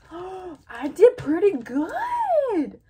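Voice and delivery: high, loud, drawn-out squeal; proud amazement. Real, not acted. Vlogger admiring own gift wrapping, loud delighted squeal. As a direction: marvel loudly at your own work.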